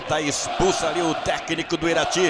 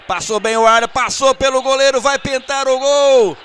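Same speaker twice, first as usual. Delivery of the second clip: building excitement; 5 semitones higher, 6 dB louder than usual. Real, not acted. Chance building toward goal.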